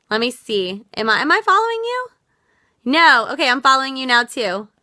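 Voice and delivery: normal talking voice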